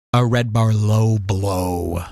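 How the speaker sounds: low, drawn-out title announcement; segment announcer. Acted. Host unveils his Red Bar segment, low slow announcer voice. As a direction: drop deep and stretch it out slowly.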